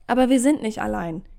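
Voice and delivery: ordinary delivery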